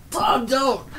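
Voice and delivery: high desperate puppet cry; pleading cry. Pretend. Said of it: "do a puppet show".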